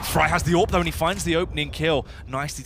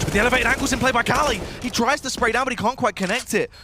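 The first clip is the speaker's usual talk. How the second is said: esports caster; 8 semitones higher, 4 dB louder than usual. Real, not acted. Caster calls tense clutch rising.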